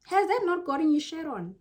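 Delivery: normal talking voice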